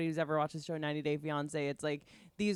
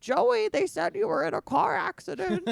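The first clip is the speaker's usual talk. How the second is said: grandma voice; 10 semitones higher than usual. Retold or imitated. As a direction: coo it like a trusting grandma.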